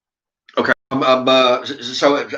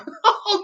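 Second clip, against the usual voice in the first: high laugh. Real, not acted. Laughs at own story.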